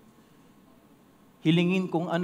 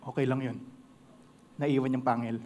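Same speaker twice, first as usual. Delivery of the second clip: lower, soft forgiving voice; calm big-cat kindness; gentle lion; 7 semitones lower, 7 dB quieter than usual. Acted. Lion gently telling the mouse it's fine, low and soft.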